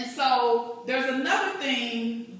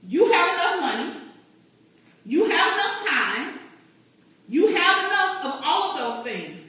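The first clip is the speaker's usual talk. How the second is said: emphatic coaching; 6 semitones higher, 4 dB louder than usual. Real, not acted. Coach rebutting excuses to room.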